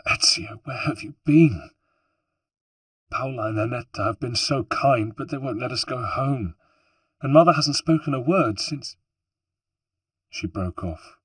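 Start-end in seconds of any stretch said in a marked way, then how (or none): 0.1-8.9 put-on high, upset young woman's voice; fighting tears. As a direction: pour it out, close to tears. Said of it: "fighting her own tears".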